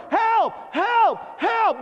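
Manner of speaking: high, short cries for help; imitated horrified screaming. Office workers after the explosion, imitated screams of horror. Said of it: "everybody started screaming in horror".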